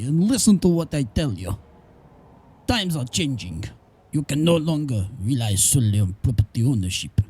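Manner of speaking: low, put-on Russian accent reading a letter; mock-serious Russian accent, voice dropping low. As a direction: read it out in a deep Russian accent. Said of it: "doing it in a Russian accent".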